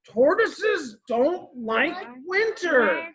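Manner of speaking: high, slow, exasperated complaint; very angry. A narrator's reading. Sleepless Tortoise scolds Squirrel, very angrily. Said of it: "said Tortoise very angrily".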